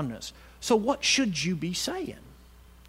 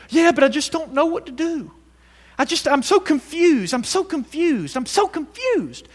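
mock confused whine; 8 semitones higher, 3 dB louder than usual. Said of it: "we say it over and over".

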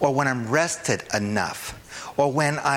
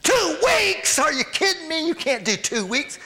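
squeaky, far higher, panicked inner voice; shocked flesh voice. Acted. Preacher's flesh shrieking at a two-week fast. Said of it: "What voice is that?".

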